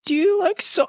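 high mock voice of a pushy kid; mocking the salesman. Retelling the solar kid at his door, in a high mock voice. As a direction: squeak it out like the annoying kid.

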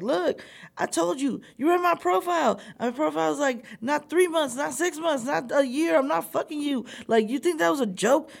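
retold high-pitched indignant tirade; angry, upset. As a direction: lay into him, pitched well up. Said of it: "I'm angry. I'm upset".

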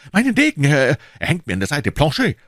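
high, loud excited shout; urgent call. A narrator's reading. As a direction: shout for sword and servant. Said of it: "rief D'Artagnan und sprang empor".

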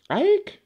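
very high, loud cat squeal; cat's squeak. A narrator's reading. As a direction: squeak sharply like a startled cat. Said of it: "squeaked the cat".

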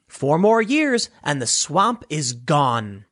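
usual speaking voice

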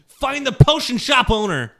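urgent order. Acted. Shaking a companion by the shoulders, urgent shouted order. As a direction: shout it like you're shaking them.

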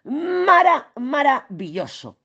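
high, slow, swooning praise; a dreamy high swoon over the word maravilloso; gushing delight. Real, not acted. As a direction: sing out how marvellous it is. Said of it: "delighted about the trine".

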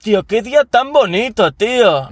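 very high, exaggerated mock delight; meta-ironic. Shown as a demonstration. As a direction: gush over the day in a squeaky parody. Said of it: "¿Eso es metaironía?".

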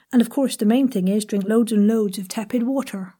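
poem recited in a light Devon accent; lilting Devon voice. Shown as a demonstration. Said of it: "that was a little Devon accent".

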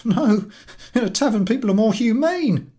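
high loud cranky retort; coughing retort. Acted. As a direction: cough out the jab. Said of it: "coughs the passenger".

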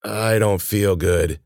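slow, drawn-out sickly voice; weak and unwell. Acted. Injured Ben tells Cece he is sick, weakly. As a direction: drag it out, feeling ill. Injured boy, tentacle in cheek.

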